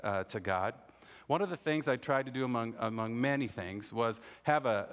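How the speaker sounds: ordinary talk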